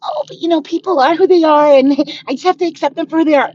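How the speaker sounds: mocking quote. Woman mocking people who say to accept everyone, put-on voice. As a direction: sneer at the forgiving line as you say it. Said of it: "I'm not gonna do the whole run around".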